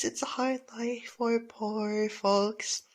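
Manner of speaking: normal talking voice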